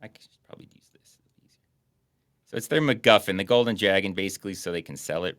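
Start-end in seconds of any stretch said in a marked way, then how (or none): 0.0-1.2 whisper. Real, not acted. Quiet aside to himself.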